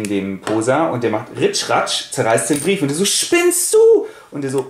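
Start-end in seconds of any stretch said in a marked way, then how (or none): 3.0-4.1 very high, loud shriek; outraged yell. Retold or imitated. Carlos yells at Posa over the torn letter, high shriek. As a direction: shriek it at your friend.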